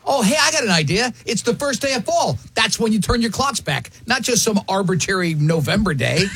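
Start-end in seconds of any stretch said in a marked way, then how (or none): 1.3-6.2 news anchor voice. Shown as a demonstration. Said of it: "my best T V news anchor voice".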